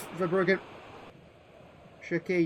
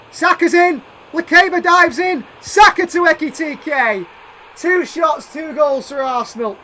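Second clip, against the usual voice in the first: loud, high rapid-fire call; alarmed shout; 6 semitones higher, 9 dB louder than usual. Real, not acted. Gamer shouting as the opponent breaks through, alarmed loud call. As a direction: shout each pass as the danger unfolds.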